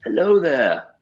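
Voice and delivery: put-on a wise old mentor greeting; Alec Guinness impression. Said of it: "I'll give you a".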